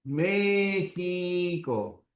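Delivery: México very slowly, the x as a j sound; slow dictation. Shown as a demonstration. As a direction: stretch out México. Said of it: "la X suena como una J".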